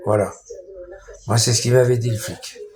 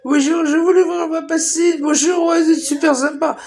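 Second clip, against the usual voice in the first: mocking high falsetto, bowing and scraping; fawning delivery man; 4 dB louder than usual. Delivery man greeting customers all day, mock fawning falsetto. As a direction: ham up an overly polite sucker-up. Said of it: "il va faire des courbettes".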